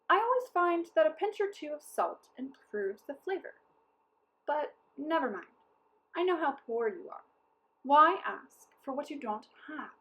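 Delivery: high, coaxing sales pitch; a cunning trickster's hint; sly hinting captain. A narrator's reading. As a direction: bait them into offering salt. Captain cunningly hints for salt.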